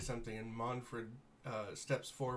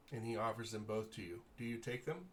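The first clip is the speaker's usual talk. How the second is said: normal talking voice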